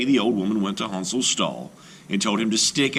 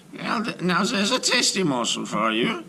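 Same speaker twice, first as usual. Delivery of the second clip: high crone voice, savouring the thought; gloating witch; 10 semitones higher than usual. Acted. Witch gloating over the sleeping children, high and greedy. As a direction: relish it like a greedy old witch. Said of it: "she thought to herself".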